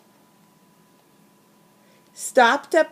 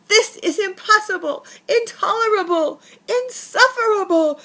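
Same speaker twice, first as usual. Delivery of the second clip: shaking with anger; 10 semitones higher than usual. Acted. Bear rages at the mouse, high furious cry. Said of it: "cried the bear, shaking with anger".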